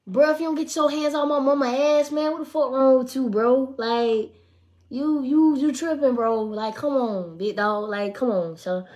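loud, heated call-out, bro; angry telling off. Retold or imitated. To the man groping her mama, a loud heated warning. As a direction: warn him off her mama.

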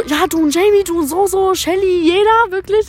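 high, loud mock accusation; imitating drunk friend. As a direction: act out the friend's loud, insistent complaint. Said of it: "dann hat sie auch gesagt so". (betrunkene Freundin, laut und hoch nachgemacht)

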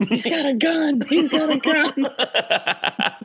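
very high, slow, laughing panic; retelling the Californians' fright at the gun; mock panic. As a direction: wail it in hammed-up terror, laughing. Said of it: "they freak out".

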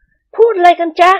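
high, gentle ladylike voice; high-voiced mistress of the house, pleased; lady of the house. A narrator's reading. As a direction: voice the madam sweetly pleased with her hair. Thada frowns, asks.

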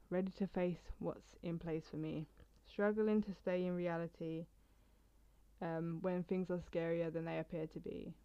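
quiet, measured poem reading. Acted. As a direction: read the poem softly, steady. Reads her poem aloud.

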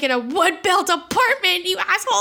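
high, exasperated outburst; retold freaking out. Re-enacting her retort to Yuri, freaked out and indignant. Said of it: "I'm freaking out".

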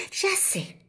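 very high eureka squeak; sudden idea. A narrator's reading. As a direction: pop out with the idea. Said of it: "se me ocurrió una idea".

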